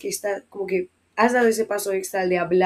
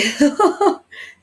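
short rising laugh; embarrassed laugh; 9 semitones higher, 7 dB louder than usual. Real, not acted. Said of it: "Perdonadme el posteo".

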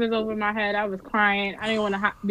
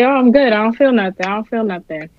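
retold cocky denial; 2 semitones higher, 8 dB louder than usual. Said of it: "I was like".

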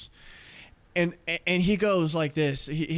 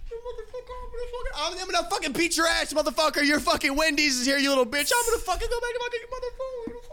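high, fast angry driver swelling from faint, then receding; muffled ranting, fading in and out; 13 semitones higher, faster than usual. Retold or imitated. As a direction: imitate a furious man passing by outside, faint to full to faint. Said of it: "he's, like, fading out. Like, he fades in".

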